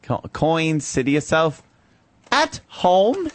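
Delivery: high mock English, shooting up on HOME; bad English accent. Co-host showing how she said the sentence, bad English accent. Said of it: "In a bad English accent".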